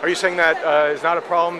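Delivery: ordinary delivery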